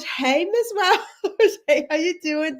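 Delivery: high, drawn-out hey through a laugh; laughing greeting. Real, not acted. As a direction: greet the viewer, laughing, stretched slow.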